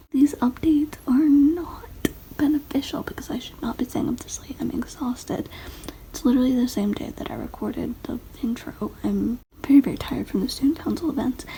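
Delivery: whispering. Real, not acted. Late-night whispered vlog.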